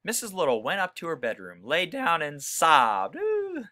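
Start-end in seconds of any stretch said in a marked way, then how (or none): 3.2-3.7 high sympathetic ooh. Acted. Teacher reacting to the sad story, sympathetic ooh. Reacting to Mrs Little sobbing.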